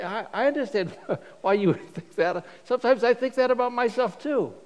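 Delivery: a light laugh through the first words, then a lift; laughing reply. Acted. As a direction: chuckle it off, humbly amused. Self-deprecating reply with a laugh.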